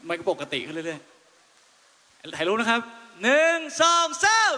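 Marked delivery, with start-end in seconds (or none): 3.2-4.5 high, loud count for the photo; loud countdown. Real, not acted. Singer counting down the group photo loudly. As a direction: call the count to the crowd. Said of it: "photo countdown".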